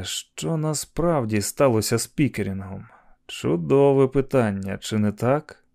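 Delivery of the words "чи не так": ordinary delivery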